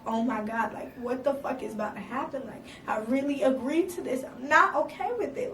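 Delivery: ordinary delivery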